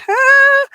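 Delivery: high, loud little cry of alarm; imitated yelp of fright; mock frightened squeal. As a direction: squeal as if cornered. Retells fright in the car.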